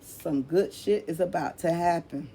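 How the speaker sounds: ordinary talk, nothing marked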